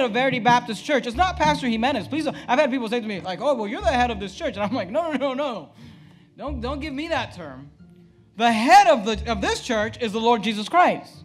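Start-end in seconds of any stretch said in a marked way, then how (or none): none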